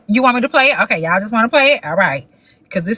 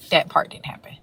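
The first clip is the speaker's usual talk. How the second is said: soft aside; 4 semitones lower, 7 dB quieter than usual. Real, not acted. Correcting the quoted line.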